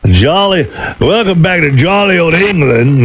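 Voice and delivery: mock English toff welcoming you back; English accent. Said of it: "That was my English accent".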